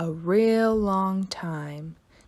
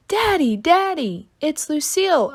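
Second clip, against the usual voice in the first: spotting a friend, excited high holler; 8 semitones higher than usual. Acted. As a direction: shout it across the room with joy. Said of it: "I hollered".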